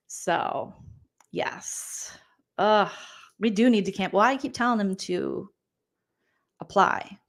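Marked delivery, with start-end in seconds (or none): none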